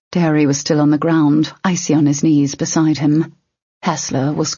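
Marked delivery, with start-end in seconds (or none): none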